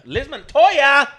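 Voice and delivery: high, drawn-out hype holler; loud shout-out. Real, not acted. Co-host echoing the listener shout-out, loud stretched holler. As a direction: belt the name out slowly like a hype man.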